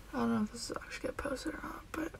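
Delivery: soft sleepy whisper; hushed morning whisper. Real, not acted. Whispering just after waking.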